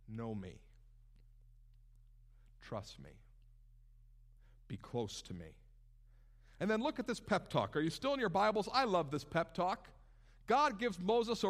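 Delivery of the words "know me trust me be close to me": very low, hushed, long pauses between phrases; soft, intimate voice of a comforting God; tender reassurance. Acted. God answers Moses in his despair, soft and reassuring.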